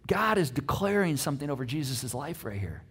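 normal talking voice

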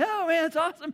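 high excited gush; delighted rider on the dip; thrilled. Retold or imitated.

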